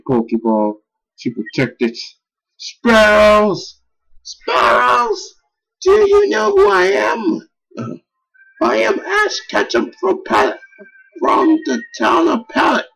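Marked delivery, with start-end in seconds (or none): 2.6-5.4 Ash calling out. Acted. Acting Ash calling birds. 5.8-12.8 Ash's heroic speech. Acted.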